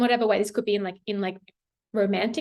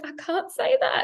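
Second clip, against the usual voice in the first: mock protest; 10 semitones higher than usual. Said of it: "I'm like".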